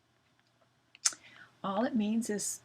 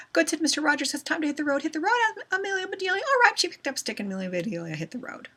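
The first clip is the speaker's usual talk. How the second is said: rushed, high-pitched gabble; reading too fast; 7 semitones higher, 4 dB louder, much faster than usual. Shown as a demonstration. Teacher shows pupils reading too fast, rushed and high. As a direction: race through the story with hardly a breath. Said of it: "That's called reading too fast".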